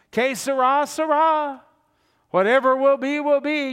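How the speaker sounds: high, loud careless singsong shrug; mock carefree. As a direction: toss it off as if nothing matters, singing it up high. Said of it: "he's basically saying".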